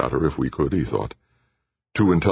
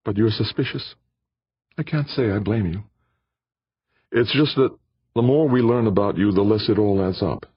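higher, lighter female voice for Aditi; Aditi's voice; 7 semitones higher than usual. A narrator's reading. Aditi answers Jordan in her higher voice.

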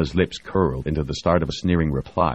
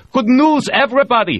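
loud, much higher call to the room; 9 dB louder than usual. A narrator's reading. Diels announces good news to everyone, calling out loud. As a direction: announce it to the whole cabin. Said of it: "she called out".

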